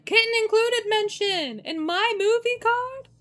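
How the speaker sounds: thrilled at a surprise; excited squeal. Real, not acted. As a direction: squeal with delight. Excited squeal over card.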